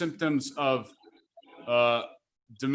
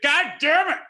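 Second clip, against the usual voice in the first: sudden high, loud frustrated curse; fed-up parent snapping at a toddler; exasperated outburst; 11 semitones higher, 6 dB louder than usual. Retold or imitated. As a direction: blow up at the end of the lecture. Dad loses patience with toddler.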